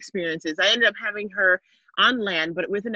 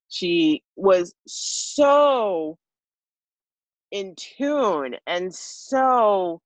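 slow, heartfelt praise; slower than usual. Real, not acted. Emotional praise of midwife.

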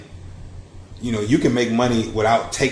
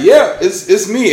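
higher, louder, laughing through it; laughing reply; 9 semitones higher, 8 dB louder than usual. Retold or imitated. Recognized by a fan at work, laughing answer.

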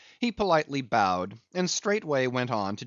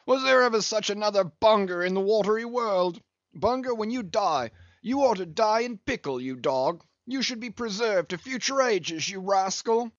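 high, hearty captain with a laugh; jovial teasing captain; 10 semitones higher than usual. A narrator's reading. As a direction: abuse your friend with gleeful affection.

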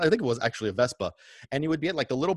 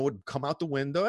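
usual speaking voice

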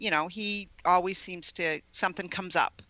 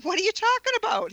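much higher, fast incredulous question; retold disbelief, pitched way up; baffled; 4 dB louder, faster than usual. Wife answering her husband's strange accusation, baffled and high.

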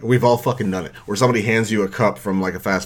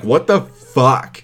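slow, drawn-out shocked outburst; shocked what the fuck; 5 semitones higher, 5 dB louder, much slower than usual. Retold or imitated. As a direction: stretch it out in disbelief. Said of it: "I'm like".

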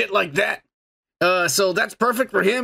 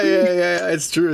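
ordinary talk

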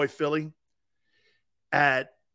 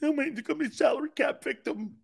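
higher, softer mocking whine; mock him as a crybaby; mock whiny victim; 8 semitones higher, 3 dB quieter than usual. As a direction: player mocked as whining about the salary cap. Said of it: "Mister Victim".

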